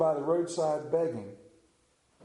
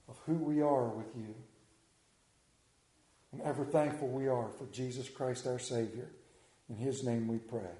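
prayer; 3 semitones lower than usual. Acted. Leads the congregation in prayer.

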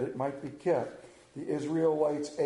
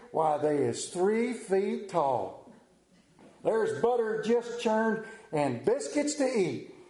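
hillbilly Hee Haw character. Acted. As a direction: ham it up like [name] on Hee Haw. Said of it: "get in character here".

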